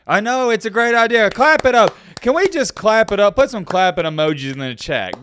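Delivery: loud fake cheer, high and bright; mock applause, overdone; sarcastic. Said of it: "I'm being sarcastic".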